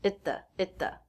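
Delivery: "Itta" quick, with T held before "the"; reduced at, stop T. Shown as a demonstration. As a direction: stop the T, slide into "the". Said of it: "so it's a stop T".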